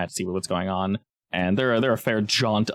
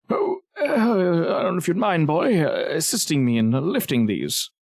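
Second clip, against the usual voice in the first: put-on higher-pitched, hesitant old-man voice; doddery old man; 6 semitones higher than usual. Old man asks a boy for help, doddery voice. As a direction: dither politely like a frail old fellow.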